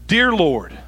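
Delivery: deliberate, unhurried address to God; measured, solemn call to the Lord; reverent prayer opening. Acted. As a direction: open the prayer firmly, then leave room. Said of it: "do I start my prayer life and I go".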